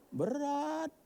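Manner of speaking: high, drawn-out wail on one held note; comic lament. Acted. Speaker lamenting to the audience about giving up pork, comic lament. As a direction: stretch the word out high.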